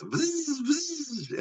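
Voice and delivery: high, thin buzzing drill noise. Retold or imitated. Paramedic showing the drill he used, high buzzing imitation. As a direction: mimic a little power drill whirring.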